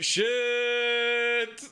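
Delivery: long drawn-out, high mock-alarmed cry. Imagining trees coming in, mock alarm. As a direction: stretch the word out high, as if trees rush at you.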